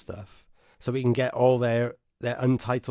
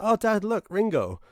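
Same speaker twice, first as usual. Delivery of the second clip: son's voice much higher and louder, thrilled; excited son; 10 semitones higher, 4 dB louder than usual. Retold or imitated. Son sees [name] on the stairs, thrilled higher voice. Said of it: "looked up the stairs and went".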